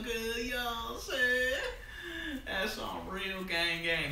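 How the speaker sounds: slow words broken by laughter; laughing while talking. Real, not acted. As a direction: laugh through the line. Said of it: "laughing through the words".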